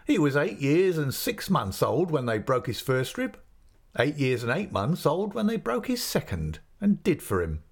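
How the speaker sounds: put-on a friendly innkeeper's man coaxing the boy; chatty waiter. A narrator's reading. Waiter at the inn teasing the boy about the ale, lightly voiced.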